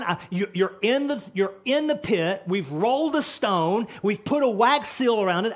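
king shouting for Daniel; anxious loud calling. Retold or imitated. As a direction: plead for an answer.

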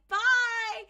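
high, loud sing-out goodbye; hammed-up doorstep send-off; fake-cheery farewell. Villains' fake farewell to neighbor.

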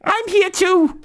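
put-on shrill, slow scolding old woman; high old lady voice. NPC Mrs Ferguson speaks.

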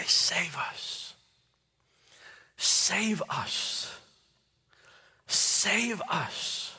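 whispered, pleading chant; hushed plea. Retold or imitated. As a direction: breathe it like a desperate crowd's prayer. Crowd chant retold softly.

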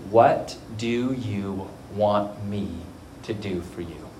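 low, slow and measured; slow, solemn question. Retold or imitated. Jesus asking the blind man what he wants, slow and solemn.

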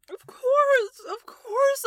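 high eager repeat; excited. Acted. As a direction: gush it out. Overjoyed apprentice accepting.

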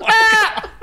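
very high, loud parrot screech with a laugh; shrieking bird cry; parrot squawk. Retold or imitated. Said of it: "it would just go".

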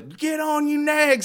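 high-pitched mocking holler; Jack Marston. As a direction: imitate an obnoxious rider calling to the horse. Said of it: "rode around as Jack, heard him call".

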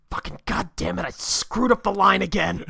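low, hushed cursing; self-berating Batman. Pretend. Co-host imagining the hero cursing himself round the corner, hushed. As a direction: mutter it comically to yourself. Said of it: "He walks around the corner and just".

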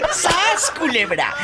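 shouted high through laughter; laughing shout, then settling; laughing catchphrase. Real, not acted. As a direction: blurt it out still laughing. Host's catchphrase after laughing.

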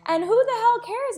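high, loud exclamation; emphatic, high-pitched brush-off; exasperated dismissal. Real, not acted. Exasperated over grandma's embarrassment.

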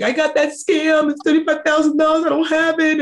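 spilling the bad news in a rush; retold tearful confession. Confessing the scam debt to her friend, high and upset. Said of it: "I just told her everything".

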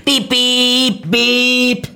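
loud, high, sustained beeps; imitated car horn. A narrator's reading. Narrator hears loud honking behind her, imitated horn. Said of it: "hörte ich ein lautes Hupen".

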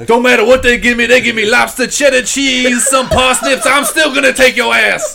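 high, loud boastful shouting; wrestling promo. Pretend. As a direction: roar threats at a rival. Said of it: "That's my promo".

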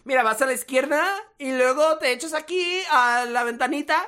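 high singsong chilango Spanish, rising lilt; Mexico City accent. Retold or imitated. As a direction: mimic the Mexico City tune. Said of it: "They go, they go".

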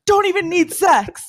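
high, slow mock voice; drawn-out fake denial. Mocking Luke.

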